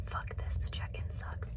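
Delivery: whispered annoyed complaint; whispering. Retold or imitated. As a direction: hiss the gripe under breath. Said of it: "I have to whisper 'cause we can't talk in here".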